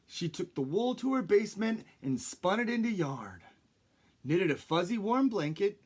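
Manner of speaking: plain reading voice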